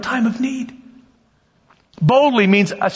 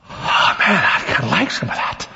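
hushed, breath-heavy longing; low, airy confession of wanting; tempted murmur; 3 semitones lower than usual. Acted. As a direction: act out giving in to a craving, half-whispered. Acting out being tempted by food.

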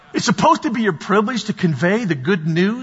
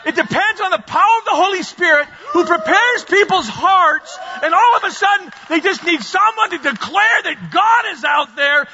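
higher, shouted proclamation; full-force preaching; 7 semitones higher than usual. Real, not acted.

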